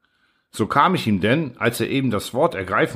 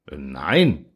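low, firm no; decided reply; 8 semitones lower than usual. A narrator's reading. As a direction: answer with mild firmness, well down in pitch. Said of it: "erwiderte er mit Entschiedenheit". (mit Entschiedenheit, tief)

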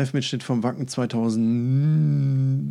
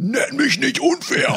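high, slow, hammed-up indignant protest; mock offended; 5 dB louder, slower than usual. Partner just called the comparison unfair, so he answers in a hammed-up, high, offended voice. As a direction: play the wounded party, squeaky and drawn out.

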